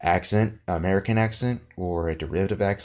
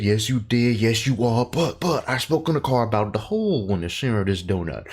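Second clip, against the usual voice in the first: hammed-up Southern detective, higher and swooping; Benoit Blanc's fake Kentucky accent; 4 semitones higher than usual. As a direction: parody a Kentucky sleuth building to a big reveal. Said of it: "obvious fake Kentucky accent".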